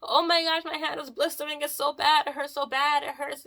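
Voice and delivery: high, whiny mock sobbing; hammed-up whimper, pitched high; mock boo-hoo crying. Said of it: "like, boo-hoo crying".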